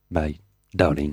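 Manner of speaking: low, sultry drawn-out delivery; languorous voice. Acted. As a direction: croon the title like a seducer. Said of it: "Dit-il d'une voix langoureuse".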